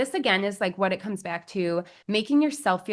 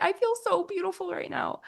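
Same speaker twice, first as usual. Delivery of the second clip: high, lightly imitated overcome bride; tearful bride; 12 semitones higher than usual. Bride at the mirror, a mildly imitated teary delight. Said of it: "She's like".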